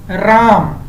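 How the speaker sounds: राम with the आ stretched long, as if calling from afar; drawn-out call. Shown as a demonstration. Teacher calling राम long to show a pluta vowel. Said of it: "यहाँ पे देख सकते हैं".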